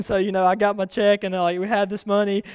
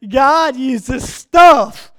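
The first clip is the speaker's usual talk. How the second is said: loud, drawn-out proclamation; emphatic preaching; 4 semitones higher, 10 dB louder, much slower than usual. Real, not acted. Preacher gives congregation his next point, emphatic shout. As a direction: declare it slowly and very loudly.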